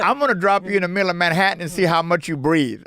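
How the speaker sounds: loud, high mock-indignant rant; retold angry outburst. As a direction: retell an exasperated threat, playing it up for laughs. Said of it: "I was so angry".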